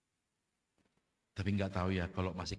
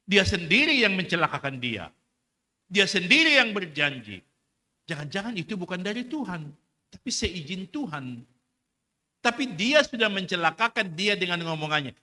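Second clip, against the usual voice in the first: higher and much louder, pounding the point home; full-force preaching; 9 semitones higher, 13 dB louder than usual. Real, not acted. Preacher rebuking the sailor's broken promise, full force. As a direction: preach it hard to the congregation.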